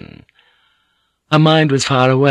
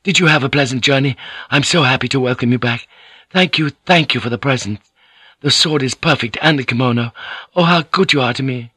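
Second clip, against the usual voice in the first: put-on eager young lover; 4 semitones higher, faster than usual. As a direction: tumble out the thanks eagerly. Inari greets his patroness eagerly.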